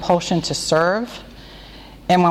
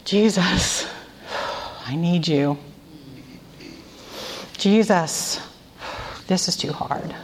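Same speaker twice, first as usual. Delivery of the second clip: quiet breath prayer; 5 dB quieter, slower than usual. Shown as a demonstration. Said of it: "I inhale and I say".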